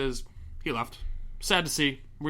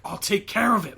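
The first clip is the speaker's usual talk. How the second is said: higher, louder gruff quote; quoting someone in character; 8 semitones higher, 4 dB louder than usual. Retold or imitated. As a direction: punch it like a hard promise. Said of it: "He's like".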